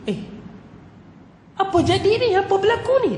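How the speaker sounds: high startled "what's going on"; startled king. Retold or imitated. Tyrant king sees the tiger spare the scholar, shocked. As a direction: pitch jumps up in shock.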